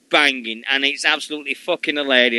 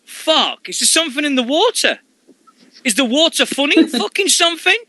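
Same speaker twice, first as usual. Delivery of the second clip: amazed quote; 10 semitones higher than usual. Retold or imitated. Quoting X-Pac's amazed reaction.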